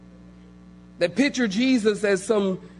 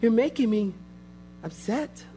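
mock soft, high, meek Jesus voice; mock movie Jesus, pitched higher and soft; 6 semitones higher, 5 dB quieter than usual. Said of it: "they got Jesus sound like [name]".

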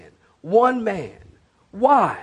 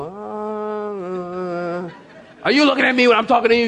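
put-on flustered kid; 2 semitones higher than usual. Kids answering father.

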